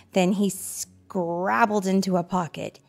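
plain reading voice

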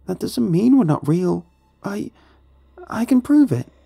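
put-on very high, urgent child; high-pitched pleading kid; child's voice. A narrator's reading. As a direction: protest like a desperate child, loud and high. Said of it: "the child responded".

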